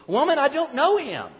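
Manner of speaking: sharp, high, loud denial; reflexive protest. Acted. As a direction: blurt the denial out in a panic. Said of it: "Peter reflexively protested".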